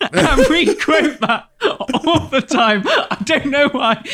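can barely get it out for laughing; giggling fit while talking. Real, not acted. Laughing about favourite quote.

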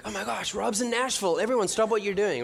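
high-pitched mock heavenly excitement; excited announcement. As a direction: announce a star arrival, playfully thrilled. Imagined heaven thrilled at his arrival.

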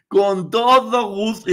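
loud, slow, high-pitched relish; gleeful eagerness. Acted. Agreeing to sell in-laws' gossip, gleeful eagerness. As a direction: savour each word with hammed-up delight.

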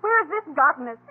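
bitter outburst. Acted. Wife lashing out at husband, building to loud anger. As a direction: let the anger build line by line.

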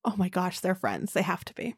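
softly amazed, almost under the breath; hushed, wondering delight; hushed awe. Real, not acted. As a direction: marvel at it quietly. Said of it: "hushed amazement at the idea".

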